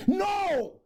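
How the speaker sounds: sudden high, loud refusal; defiant shout. Acted. Refusing the voices of doom, a defiant shout. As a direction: cut it off with a yelled no.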